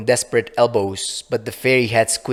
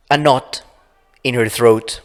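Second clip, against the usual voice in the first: ordinary delivery, nothing marked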